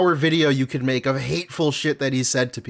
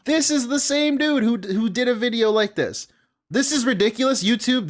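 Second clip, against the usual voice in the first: higher, worked-up disbelief; incredulous outburst; 6 semitones higher than usual. Real, not acted. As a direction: pitch it up in outrage at the clip. Outraged at the uploaded video.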